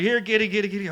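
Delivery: sing-song kitty call; calling a cat. Retold or imitated.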